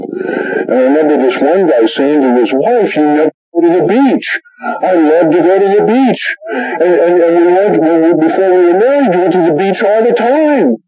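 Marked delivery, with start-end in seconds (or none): 2.9-10.8 high-pitched mock complaint; complaining husband. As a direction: act out a spouse's grievance, voice pitched up. Husband complains to wife, acted marriage quarrel.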